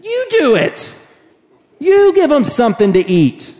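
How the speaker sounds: high emphatic you do it; Jesus commanding. Retold or imitated. Jesus tells disciples to feed crowd, emphatic. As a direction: insist firmly on each you. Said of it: "and he says".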